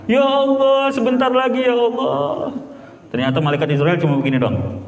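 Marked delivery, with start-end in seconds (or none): 0.1-2.5 much higher mock desperate wail; crying harder. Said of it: "makin dekat lagi". ([name] meratap makin tinggi, pura-pura putus asa)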